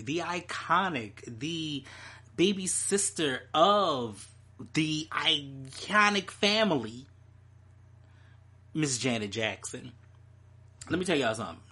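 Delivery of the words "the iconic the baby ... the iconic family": slow, drawn-out announcer build; grand slow build. Real, not acted. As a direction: stretch each title out grandly.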